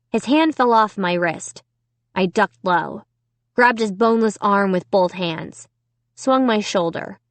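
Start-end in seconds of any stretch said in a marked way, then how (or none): none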